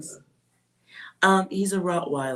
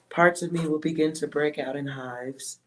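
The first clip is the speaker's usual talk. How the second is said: ordinary talk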